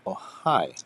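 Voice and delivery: usual speaking voice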